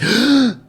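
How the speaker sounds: sharp gasp. Retold or imitated. Guy gasps at dropped gun.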